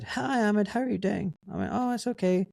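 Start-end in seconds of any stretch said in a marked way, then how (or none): 0.0-1.3 imitated hospital director. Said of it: "he rang me and said". 1.7-2.5 high, obliging phone greeting; helpful reply in a raised voice; retold friendly answer. Retold friendly answer to director's call.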